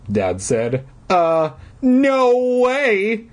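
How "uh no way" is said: put-on very slow, high, louder kid refusal; drawn-out no way. A narrator's reading. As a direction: stretch it out like two stubborn kids. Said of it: "answered together".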